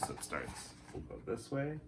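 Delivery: ordinary delivery, nothing marked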